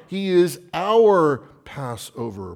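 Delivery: OUR punched high and loud; stress on OUR. Real, not acted. Preacher claiming the Passover for believers, emphatic on OUR. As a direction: lean hard on "our", stretching it above the rest.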